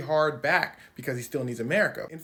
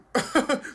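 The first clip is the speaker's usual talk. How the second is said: loud, higher mock laugh; 11 semitones higher, 8 dB louder than usual. Vance laughing at Zelensky.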